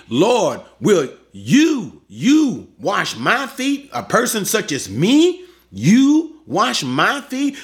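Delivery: YOU drawn out slowly with a rising, swooping pitch; incredulous stress on YOU. Acted. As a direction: lean hard on "you", indignant that it's you. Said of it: "Peter puts the emphasis on the person of Jesus".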